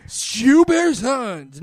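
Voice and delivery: slow, loud, high mocking drawl. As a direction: stretch the title out to mock it. Mocking the book title.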